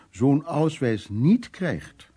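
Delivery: a stern aunt spelling out the verdict; slow emphatic. Retold or imitated. Quoting aunt's verdict.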